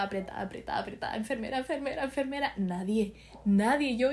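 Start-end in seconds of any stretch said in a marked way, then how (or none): none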